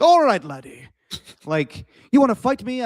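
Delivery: high, loud attempt at a Scottish brogue; hammed-up Scottish accent. Mimicking Scottish shin kickers calling out a challenge, exaggerated Scottish accent. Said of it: "I can't do a Scottish accent".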